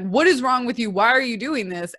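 irritated yell at the dog; snapping at dog. Retold or imitated. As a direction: scold the barking dog. Yelling at her barking dog.

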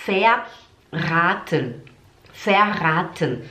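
Verraten slow with a drawn-out A; long A. Shown as a demonstration. Teacher models the long vowel, slow stretched Verraten. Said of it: "É um A longo".

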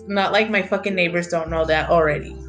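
ordinary delivery

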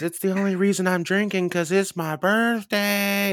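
put-on high, slow old lady voice. Narrator imitating the old birthday lady, high and slow. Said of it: "she goes".

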